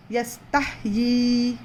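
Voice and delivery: यस्ताहयी slowly with the ता held long; slow recitation. Shown as a demonstration. Teacher reciting यस्ताहयी slowly for the class. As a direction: draw out यस्ताहयी syllable by syllable. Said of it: "दो हरकत जितना खींच कर पढ़ना है".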